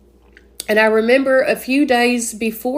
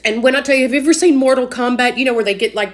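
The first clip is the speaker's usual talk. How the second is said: ordinary delivery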